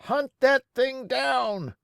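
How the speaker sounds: rally the crowd to hunt; angry townsfolk. A narrator's reading. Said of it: "they cried".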